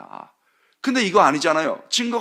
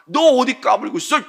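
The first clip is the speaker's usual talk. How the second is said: higher, louder angry scolding; angry rebuke; 10 semitones higher, 6 dB louder than usual. Retold or imitated. Council shouting down Nicodemus, angry rebuke. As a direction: put him in his place.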